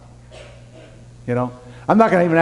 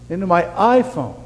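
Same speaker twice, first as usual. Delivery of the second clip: normal talking voice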